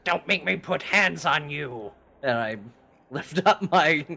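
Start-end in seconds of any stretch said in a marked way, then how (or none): 0.0-1.9 raised, loud comeback; mock threat. Player answers the tabaxi, loud mock threat. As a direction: throw the threat back louder.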